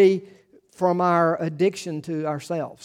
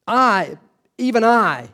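"even I" pushed higher and louder; emphatic I; 5 semitones higher, 4 dB louder, slower than usual. Shown as a demonstration. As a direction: stress "I" like God insisting. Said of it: "read it with some emphasis".

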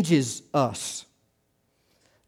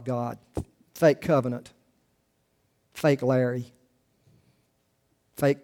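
closing prayer; 3 dB quieter than usual. Acted. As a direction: pray quietly for the congregation. Closing prayer after "Let's pray.

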